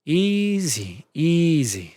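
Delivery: calm a panicked friend; soothing easy. Acted. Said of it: "rubbing her back".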